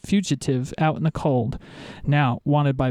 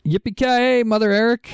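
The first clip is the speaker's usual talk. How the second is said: Die Hard catchphrase; 7 semitones higher, 3 dB louder than usual. Pretend. Die Hard catchphrase opening show.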